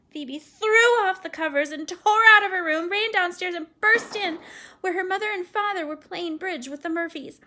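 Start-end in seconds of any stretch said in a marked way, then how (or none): none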